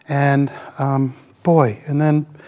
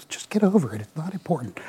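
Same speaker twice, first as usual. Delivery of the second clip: dismissive hush; 7 dB quieter, faster than usual. Retold or imitated. Wife dismisses his complaint.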